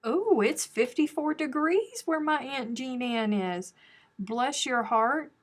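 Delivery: teasing sympathy. Pretend. Teasing aunt about weather.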